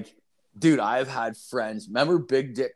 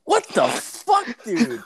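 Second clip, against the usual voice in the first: high, loud, drawn-out disbelief; retold shocked outburst; 9 semitones higher, 5 dB louder, much slower than usual. Retelling his shocked reply to an oversharing coworker, high incredulous outburst. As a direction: blurt it out slowly in a high, incredulous yelp.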